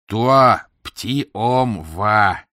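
very slow, deliberate queen voice; slow broken French. Acted. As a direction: spell it out slowly, regally.

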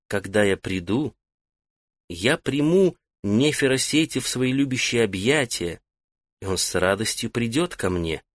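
soft melodic female voice, hushed; мелодичный женский голос. Acted. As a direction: speak as the goddess through him, gently. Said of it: "заговорил не своим голосом, а мелодичным женским". (мелодичный женский голос богини)

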